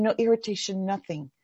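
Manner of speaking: ordinary delivery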